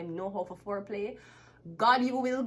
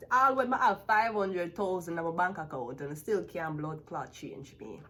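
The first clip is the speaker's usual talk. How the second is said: normal talking voice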